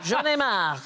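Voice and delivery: J'en ai marre said in French, laughing; French phrase. Shown as a demonstration. Host teaching panel a French phrase, said laughing. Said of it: "I'm fed up" in French".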